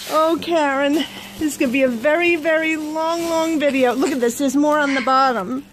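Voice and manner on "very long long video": normal talking voice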